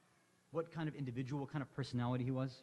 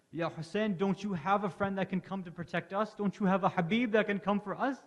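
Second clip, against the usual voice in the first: urgent plea; 7 semitones higher, 5 dB louder than usual. Acted. Zainab pleading with her brother.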